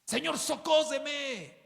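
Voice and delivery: high, strained plea for rescue; mock drowning cry. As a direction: cry out as if sinking. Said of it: "se va a empezar a ahogar".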